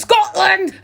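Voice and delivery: loud high bellow of a battle cry; shouting at the top of his voice. A narrator's reading. Will shouting at strangers, full-voiced bellow. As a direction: roar it proudly. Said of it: "at the top of his voice".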